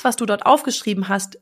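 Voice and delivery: ordinary talk, nothing marked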